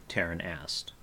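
ordinary delivery

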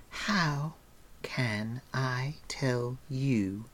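very slow, every word set apart; mock-slow, drawn-out sarcasm; deliberate word-by-word. A narrator's reading. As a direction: pronounce each word with exaggerated care. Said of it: "deliberately pronouncing every word".

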